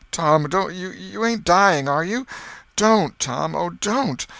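put-on high, loud, frightened boy; panicked pleading. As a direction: plead as if he might die. Sid fears Tom is dying.

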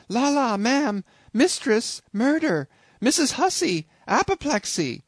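high, alarmed cries for help; panicked cries. A narrator's reading. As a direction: shriek for the mistress in panic. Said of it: "with these cries".

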